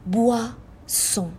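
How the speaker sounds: بواسون said very slowly, oi as وا; French pronunciation, slowly. Shown as a demonstration. Teacher slowly modelling the drink word for students. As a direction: draw out بواسون syllable by syllable.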